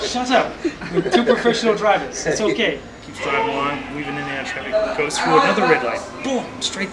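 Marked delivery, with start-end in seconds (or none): 0.1-2.8 high, louder snap with a laugh; mock-gruff driver with a laugh; cab driver snapping back. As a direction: brush off the passengers, laughing. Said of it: "So the guy turns around and goes".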